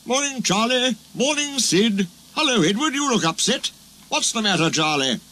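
lightly voiced cheerful vicar. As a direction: greet the crew like a friendly vicar.

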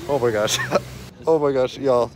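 loud, high oh my gosh; excited shriek. Real, not acted. On the ski lift, to friends, an excited shriek. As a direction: burst out in nervous excitement.

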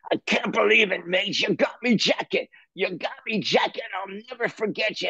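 high, effusive character voice; [name] overjoyed. Retold or imitated. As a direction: imitate a drummer gushing with gratitude. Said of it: "And he says".

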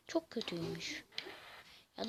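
sessizce fısılda; sır verir gibi fısılda; fısıltı. Real, not acted. Kaleci hakkında fısıltılı yorum. (kaleci için fısıltıyla söylenen yorum)

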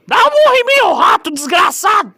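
high, loud enraged yelling; furious shout. Acted. Ozzy yells at the clumsy guy who broke the vodka, furious shout. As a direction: scream the insult right at him. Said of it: "desculpa pela gritaria".

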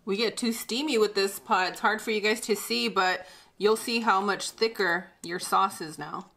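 normal talking voice

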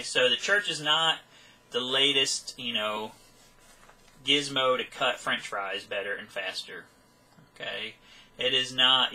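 ordinary talk, nothing marked

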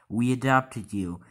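dad spelling it out to his son, slow and loud. Acted. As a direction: spell it out slowly and loudly. Dad explains adoption to son.

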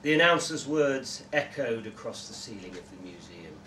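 ordinary delivery

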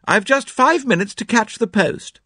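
high, carrying call with time running short. A narrator's reading. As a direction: call it through to the next room, high. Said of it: "she called".

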